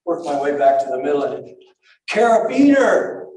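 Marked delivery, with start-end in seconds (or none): 2.1-3.2 sharp high yell, much louder; screaming carabiner. Retold or imitated. Shouting to Kyle in the cold water, high loud yell. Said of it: "I scream".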